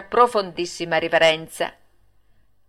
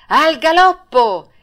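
much higher and louder, slowly drawn out; loud shouted command; 8 semitones higher, 8 dB louder, slower than usual. Acted. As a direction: bellow it over the arena. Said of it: "il direttore gridò".

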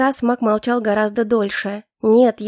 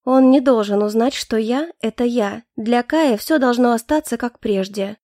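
ordinary talk

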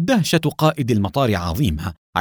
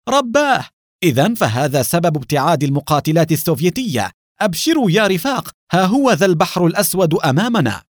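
joyful shout; 6 semitones higher than usual. Acted. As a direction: announce the good news with relief and glee. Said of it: "صاح بسرور".

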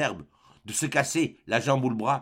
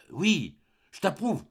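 slow, hesitant, oddly strained approval; odd reluctant; 6 semitones higher, slower than usual. A narrator's reading. Mother grudgingly approves her son's marriage, slow and hesitant. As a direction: drag out an unconvincing yes. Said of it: "Comme tu dis ça drôlement".

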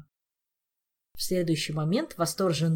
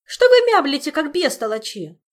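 high, loud angry scolding; exasperated yell; 9 semitones higher, 12 dB louder than usual. Acted. Olga scolding friends who will not explain, exasperated shout. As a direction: lash out at their mumbling. Olga's hysterical outburst continues.

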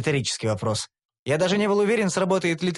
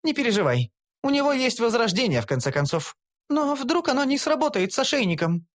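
higher, anxious voice; lightly voiced girl, worried; 9 semitones higher than usual. A narrator's reading. Companions worrying over hero, anxious higher voice. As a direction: fret aloud. Gnome and elf dialogue.

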